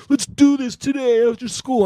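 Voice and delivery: put-on high, strained voice for the kid's challenge; mock tough kid. Kid challenges him to fight.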